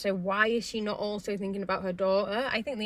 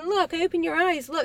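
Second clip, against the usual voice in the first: higher, louder coaxing look; urging doctor; 9 semitones higher, 4 dB louder than usual. Retold or imitated. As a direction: coax her to open her eyes. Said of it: "the doctor's picking her up in the air saying".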